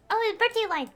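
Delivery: excited. Real, not acted. Spots the character's birthday voiceline.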